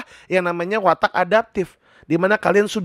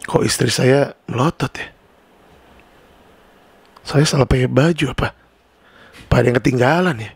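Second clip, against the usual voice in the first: confused husband; 7 semitones lower than usual. Acted. Confused husband wonders aloud.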